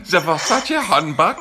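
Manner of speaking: mock the accent on "Versace handbag"; mimicked accent. Mimicking her pronunciation of handbag.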